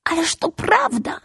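put-on high little boy assuring; insisting little boy. A narrator's reading. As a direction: convince him, pleading. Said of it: "zapewniał mały". (malec zapewnia wysokim głosem)

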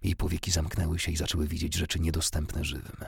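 ordinary delivery, nothing marked

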